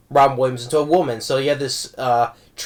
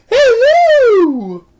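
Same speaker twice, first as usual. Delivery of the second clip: put-on much higher, louder old lady falsetto Hello; Mrs Doubtfire falsetto; 9 dB louder than usual. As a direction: sing out Hello in a high granny falsetto. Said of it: "goes with the frosting on his face and say".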